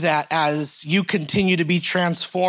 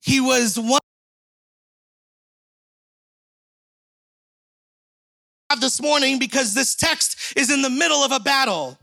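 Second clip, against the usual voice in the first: raised, emphatic preaching voice; 8 semitones higher than usual. Acted. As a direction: preach to the room from the top. Preaching opens.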